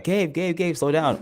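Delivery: mock call to rein him in; pleading cohost. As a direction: cohost imitating someone begging Gabe to slow down. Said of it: "be like".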